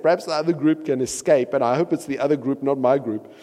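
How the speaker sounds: animated Bible reading; scripture reading. Acted. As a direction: tell it to the congregation with drama. Reads scripture.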